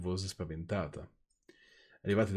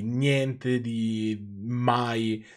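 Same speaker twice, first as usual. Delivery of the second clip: mock drawl; 5 dB louder, much slower than usual. Mocking the story's lack of senses.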